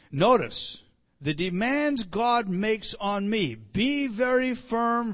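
loud, slow emphasis; preaching loud. Real, not acted. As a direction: press each word to the fathers.

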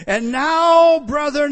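And now high and loud; loud proclaimed appeal. Acted. Peter addressing the brethren, loud high appeal. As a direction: call out like Peter.

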